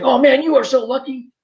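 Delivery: high, eager voice of the other recruits; envious excitement. Retold or imitated. Recruits telling the new TD how lucky he is, high and eager. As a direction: gush like jealous shipmates. Everybody envies his assignment.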